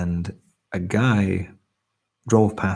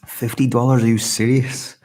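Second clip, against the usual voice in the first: surprised; 4 semitones higher, 5 dB louder than usual. Real, not acted. Reacting to a large donation.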